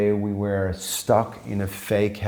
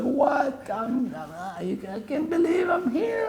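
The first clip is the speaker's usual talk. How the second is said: very high, soft mock-tearful squeal; hammed-up crying with joy, voice climbing very high; overwhelmed disbelief; 4 dB quieter than usual. Guest reacting to his first introduction, mock-tearful high disbelief.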